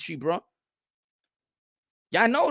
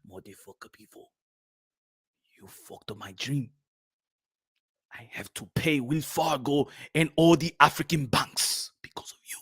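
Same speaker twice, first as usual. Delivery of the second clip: menacing boxer; 4 semitones lower than usual. Acted. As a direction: murmur it with wounded menace. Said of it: "He's going to be like this".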